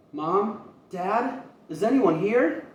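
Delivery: put-on child calling mom and dad. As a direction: call out hopefully for family. Boy calls through empty house.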